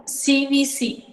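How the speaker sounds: CVC said as सीवीसी, letter by letter; syllable shape spelled out. Shown as a demonstration. Speech therapist naming the syllable pattern, letter by letter. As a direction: spell out सीवीसी clearly, each letter apart. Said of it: "consonant vowel consonant".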